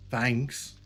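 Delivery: ordinary delivery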